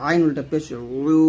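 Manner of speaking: উ after আইন pressed out from mid-throat, slowly; pressed ain from mid-throat. Shown as a demonstration. Teacher shows students the pressed throat ain. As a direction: squeeze আইন from the middle of the throat. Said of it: "আইনটা চাপ দিয়ে নিয়ে আসবেন হলকের মাঝখান থেকে".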